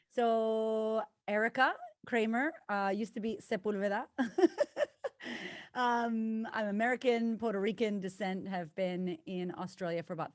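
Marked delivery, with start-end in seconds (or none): 4.1-5.7 bright giggle. Real, not acted. Laugh after mentioning maiden name.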